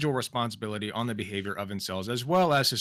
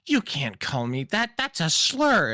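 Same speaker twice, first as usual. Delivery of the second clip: whiny mock outrage; 6 semitones higher, 4 dB louder, slower than usual. Mocking someone offended.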